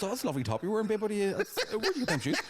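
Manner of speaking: high, overly sweet chatter breaking into laughter; fake sweet chat. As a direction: charm her to cover up, laughing. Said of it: "trying to chat to her".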